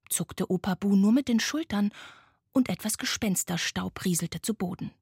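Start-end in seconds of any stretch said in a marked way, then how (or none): none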